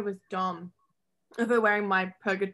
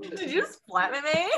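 teasing; 8 semitones higher than usual. Retold or imitated. Mock flirt accusation.

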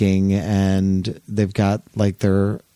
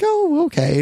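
much higher, sing-song oh okay; high polite oh okay. Retold or imitated. Lady Bird answering the startled Carol, high sweet tone. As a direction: answer sweetly, a little thrown.

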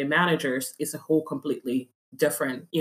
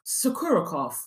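softer mock Russian accent; 5 dB quieter than usual. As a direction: say Sokurokov like a Russian. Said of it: "in your best Russian accent".

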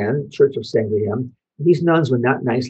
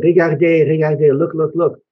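put-on raised, pleading child calling look; a small boy begging mom to see his hands; urgent child; 4 semitones higher, 5 dB louder than usual. Boy showing swollen knuckles to mom, raised and urgent.

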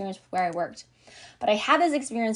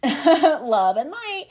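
higher, louder and slow, laughing through it; laughing mockery; 5 semitones higher, 5 dB louder, much slower than usual. Mocking the fake spiritual crowd, laughing it out loud.

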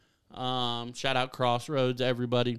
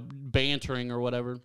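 ordinary delivery

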